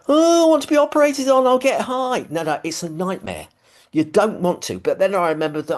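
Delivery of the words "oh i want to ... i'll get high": high, fast, eager mock voice; mocking naive patient. Mocking people who want surgery for the drugs, high eager voice. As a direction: parody someone hoping surgery gets them high. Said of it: "people go".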